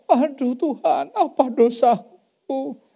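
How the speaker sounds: wife asking God what her sin is, slow and anguished; anguished cry. Retold or imitated. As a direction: drag it out in despair. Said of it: "wife crying out to God".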